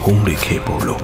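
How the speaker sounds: plain reading voice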